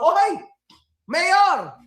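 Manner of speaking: high, loud call to the mayor; cheerful shout-out. Real, not acted. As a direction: hail him across a room. Calling out to the mayor.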